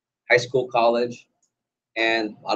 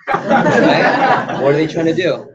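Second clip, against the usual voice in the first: ordinary talk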